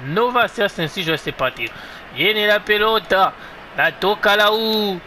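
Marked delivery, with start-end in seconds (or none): 2.1-5.0 excited football commentator voice; live play-by-play. Acted. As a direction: call the match as it happens. Live commentary of his match.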